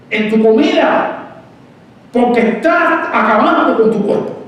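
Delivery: high, loud preaching cry; preaching at full force. Acted. Preaching build-up.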